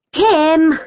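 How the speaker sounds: high-pitched call upward. A narrator's reading. Amber calls to sister up the ladder, calling out. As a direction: call up to someone overhead. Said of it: "I called".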